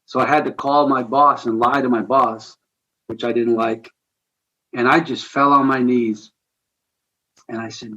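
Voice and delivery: usual speaking voice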